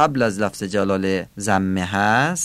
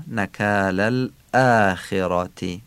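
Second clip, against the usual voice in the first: نکال الآخرة slowly, with the hamza of الآخرة struck and held long; stressed hamza; much slower than usual. Shown as a demonstration. Teacher pointing out the second hamza in نکال الآخرة, stressed hamza. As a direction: hit the hamza opening الآخرة. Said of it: "دو تا نبر همزه داریم".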